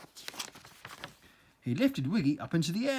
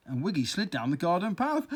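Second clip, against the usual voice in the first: ordinary delivery, nothing marked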